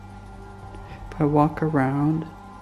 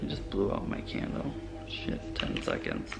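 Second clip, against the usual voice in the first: hushed, voiceless muttered whisper; nervous night vlog whisper; 9 semitones lower, 7 dB quieter than usual. Real, not acted. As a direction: whisper it under your breath, startled. Candle blown out at night.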